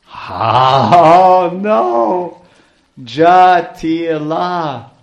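loud, high, drawn-out wail of dread; screeched alarm. Acted. Crying out that the dreaded mother-in-law is coming, loud screeched alarm. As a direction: cry it out slowly, at full voice. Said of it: "screeched out in her loud".

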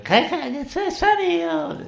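put-on very high, screechy ninety-year-old woman; squeaky old lady. Imitating a ninety-year-old lady, screechy, squeaky voice. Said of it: "screechy, squeaky voices".

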